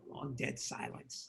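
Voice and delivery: slow, soft unvoiced words; hushed. Real, not acted. Storyteller describing the awkward silence, hushed. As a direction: let it hang, hushed and slow.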